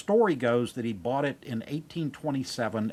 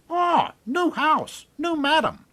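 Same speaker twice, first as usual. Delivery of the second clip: very high, slow, screechy parrot voice; squawking parrot; 5 dB louder, slower than usual. Acted. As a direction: squawk it out like a parrot in a new home. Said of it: "Parrot looks at her and goes".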